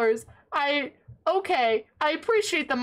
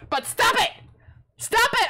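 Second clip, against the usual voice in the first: exasperated protest; 10 semitones higher, 4 dB louder than usual. Real, not acted. Protesting the generosity.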